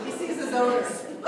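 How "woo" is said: joyful whoop; quick bright whoop; excited whoop. Shown as a demonstration. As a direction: cheer like a winner. Demonstrating excitement.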